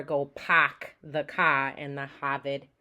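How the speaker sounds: slow, deliberate Boston accent; drawn-out non-rhotic Boston demo. Shown as a demonstration. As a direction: drop every R, Boston style. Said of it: "speakers often drop from the letter R".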